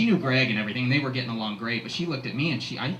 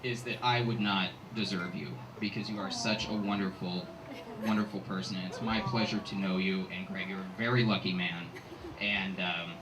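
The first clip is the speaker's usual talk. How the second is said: sincere toast; 5 semitones lower, 10 dB quieter than usual. Real, not acted.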